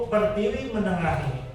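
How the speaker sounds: normal talking voice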